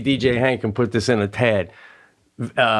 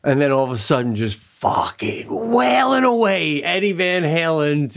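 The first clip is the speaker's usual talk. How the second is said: normal talking voice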